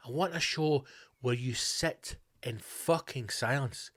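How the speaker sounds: usual speaking voice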